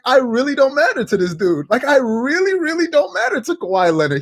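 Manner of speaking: high, whiny mock hurt; hurt whining. Stephen A. hurt that Kawhi ignores him, high mock whine. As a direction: pout it like a wounded ego. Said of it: "he's like".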